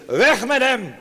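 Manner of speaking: high, loud mock cry of the mob; crowd's shout. Preacher voicing the crowd against Jesus, a loud shout.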